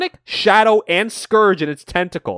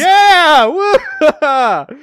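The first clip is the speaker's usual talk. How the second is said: high loud whoop with a laugh; cheering; 10 semitones higher, 7 dB louder than usual. Real, not acted. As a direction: cheer for the good guys. Cheering the fight.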